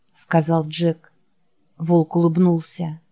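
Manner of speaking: plain reading voice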